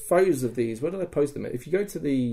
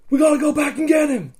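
loud, very high urgent cry; rally the posse to turn back; retold rallying shout; 5 dB louder than usual. Player urges a rescue, high rallying shout.

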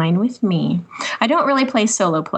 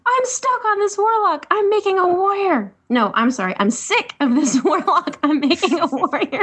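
retold shouting; 8 semitones higher than usual. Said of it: "shouting something along the lines of".

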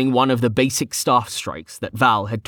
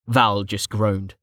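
plain reading voice

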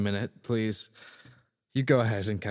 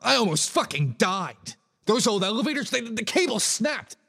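upset and shaken; 10 semitones higher than usual. Acted. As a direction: blurt it out still panicked. Shaken after nearly dying.

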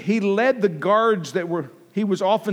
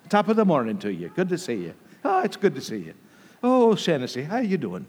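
put-on phony Irish accent. Pastor greets church friends in a playful phony Irish accent. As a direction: put on a jokey fake Irish lilt for friends. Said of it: "our phony Irish accent".

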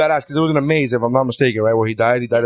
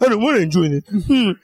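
higher-pitched mock sulk; pouty; 8 semitones higher than usual. Pretending to sulk at the theme park, mock pouty. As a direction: pout like a reluctant child. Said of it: "with a pouty face".

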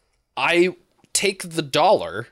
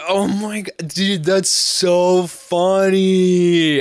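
put-on drunk gushing; 6 semitones higher, 6 dB louder, much slower than usual. Drunk friend Courtney gushing.

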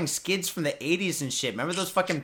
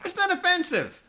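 high, laughing hammed-up voice; laughing mock voice. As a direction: squeal it through laughter. Mocking the offender, laughing.

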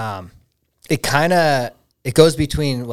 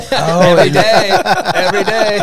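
laughing; 6 semitones higher, 7 dB louder than usual. Real, not acted. Laughing reply.

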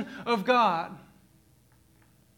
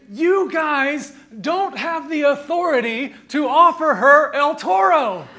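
scolding dad; 6 semitones higher, 10 dB louder than usual. Retold or imitated. Dad scolding kids in car.

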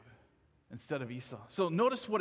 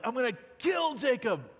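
acted angry outburst, higher pitched; upset threat; 5 semitones higher, 4 dB louder than usual. Brother upset at losing the blessing, acted angry threat. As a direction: hammer out the threat in mock fury. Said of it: "so upset at losing the blessing".

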